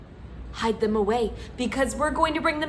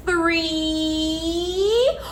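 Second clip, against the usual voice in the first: ordinary delivery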